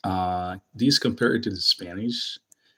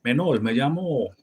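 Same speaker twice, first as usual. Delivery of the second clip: Dominican Spanish; 6 semitones higher, 5 dB louder than usual. Shown as a demonstration.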